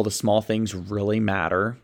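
ordinary talk

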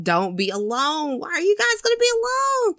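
a viewer yelling at a horror movie in mock alarm; pleading at the screen. Real, not acted. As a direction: beg the characters not to split up, voice climbing. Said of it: "I'm just like".

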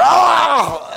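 loud, high fake scream of shock; hammed-up pained shout; mock electrocution yell. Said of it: "I'm hopping around".

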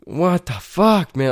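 frustrated. Retold or imitated. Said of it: "I'm just like".

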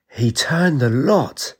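mild tearful wail; tearful cry. A narrator's reading. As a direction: choke it out, eyes watering. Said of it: "His eyes watered and he cried".

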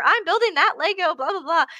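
put-on high, petulant child voice; high mock kid bickering; kid squabble.

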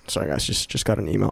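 softly excusing himself to listeners; quiet aside. Real, not acted. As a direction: drop to a hushed murmur while distracted. Aside while checking his phone.

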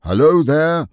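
put-on loud, high boyish yell back; a boy bellowing back at the enemy; bellowed. A narrator's reading. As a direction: shout it across the moat. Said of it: "Robert bellowed back at once".

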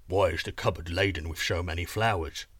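low giant rumble; questioning giant. Acted. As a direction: ask it as a puzzled, deep-voiced giant.

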